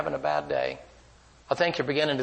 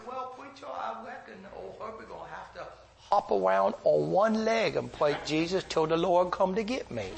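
put-on a humble old man joking about his fate; Herbie's folksy voice; 7 semitones higher than usual. Herbie voiced answering from hospital bed.

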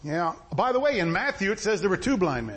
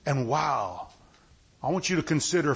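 usual speaking voice

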